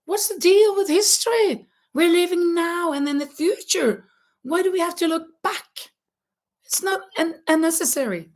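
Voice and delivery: high-pitched mock indignation; exasperated, high rhetorical questioning; baffled complaint. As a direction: whine like a puzzled young student. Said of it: "I didn't understand".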